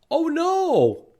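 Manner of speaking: high, dismayed gasp of oh no; alarmed. Acted. Reader reacting to the eggs hatching, alarmed. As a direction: sound caught off guard.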